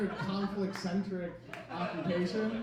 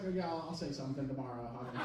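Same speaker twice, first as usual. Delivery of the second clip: mumbled brush-off; 8 semitones lower, 9 dB quieter, much faster than usual. Retold or imitated. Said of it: "And I'm just like".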